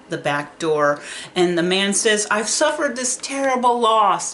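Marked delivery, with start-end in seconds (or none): 2.3-4.3 higher, louder, voice pushed by grief; a grieving father choking out the words; sobbing father. Retold or imitated. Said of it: "he's crying and he's sobbing".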